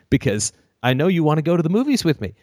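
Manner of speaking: high, fast, laughing asker; breathless chicken-suit plan; overeager suitor. Retold or imitated. Stalker suitor voiced.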